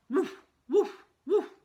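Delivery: put-on high, loud toddler woof woof; puppy barking. A narrator's reading. Toddler under table pretending to be a dog, high barks. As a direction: bark like a little puppy. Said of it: "He said".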